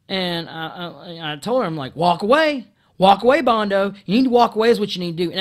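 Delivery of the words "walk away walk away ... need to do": louder, insistent order, last line fast; telling the coworker to leave, loud and firm; firm loud command. Retold or imitated. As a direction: order her out, pointing at the door. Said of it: "I just repeated that".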